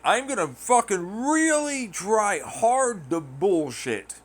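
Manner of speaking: high, slow sarcastic put-on; mock phony voice. Mocking people who bullshit to be liked, slow sarcastic put-on. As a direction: sneer through a fake voice.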